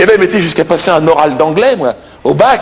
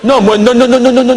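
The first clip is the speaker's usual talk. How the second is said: raised-pitch string of refusals; insistent protest; 4 semitones higher than usual. Acted. Candidate haggling with the examiner over his mark, insistent protest. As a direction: cut in with insistent objections.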